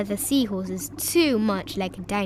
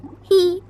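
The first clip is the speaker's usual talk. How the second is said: loud giggle; 8 semitones higher, 9 dB louder than usual. A narrator's reading. Laugh after the song.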